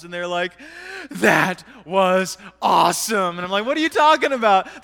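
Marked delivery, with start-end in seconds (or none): 1.1-3.4 big, loud, drawn-out mock couple's rave; thrilled rave. Couple's thrilled reaction, acted out. 3.6-4.8 loud, high incredulous retort; baffled disbelief. Retold or imitated. His baffled reply, acted out.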